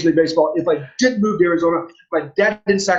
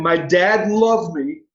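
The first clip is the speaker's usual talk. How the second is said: every word slow and weighted, a stress on "loved"; slow emphatic repeat; much slower than usual. Shown as a demonstration. Asked by the host to say it again, slow and weighty. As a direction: lean hard on "loved" as if it's a revelation. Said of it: "Say it again".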